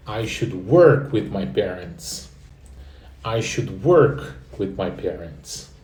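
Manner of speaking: slow, careful teacher model of "I should work with my parents"; slow model sentence. Shown as a demonstration. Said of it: "Repete em voz alta". (frase modelo devagar, para repetir)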